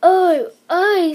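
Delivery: wife sobbing over her wings. A narrator's reading. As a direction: sob each oh out. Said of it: "sobbed Missus Gregg".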